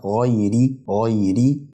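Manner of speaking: "غيري" as ghay-ri, drawn out; "غيري" recited slowly with ri at the end; raa with kasra. Shown as a demonstration. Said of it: "هي مكسورة".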